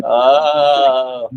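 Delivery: long, loud, high mock wail; imitated crying scream. Mocking Bobby's crying and screaming on stage, drawn-out mock scream. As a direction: wail it out, imitating an overwrought singer. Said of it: "Bobby crying on stage pretty much and screaming".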